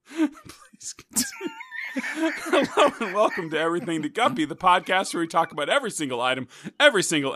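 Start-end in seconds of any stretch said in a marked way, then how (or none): 0.1-1.5 high squeaky voice through laughter; helpless laughing. Real, not acted. Podcast host begging co-host to continue, helpless laughing. Cracks up repeating the request. 1.9-4.5 podcast welcome through leftover giggles; laughing intro. Real, not acted. Host welcoming listeners to the podcast, laughing.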